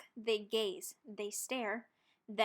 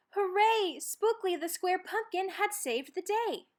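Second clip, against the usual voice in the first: high, loud storybook cheer; cheering shout; 7 semitones higher, 4 dB louder than usual. A narrator's reading. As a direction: cheer it out for the whole patch. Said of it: "All the pumpkins shouted".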